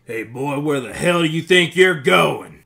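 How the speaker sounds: loud, high confrontational shout; angry call after someone. Acted. Bar stranger shouts at someone walking away, aggressive yell. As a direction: holler it across the bar.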